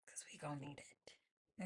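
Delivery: breathy whispered aside. Real, not acted. Podcaster whispering a warning to listeners. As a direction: drop to a whisper, half to yourself.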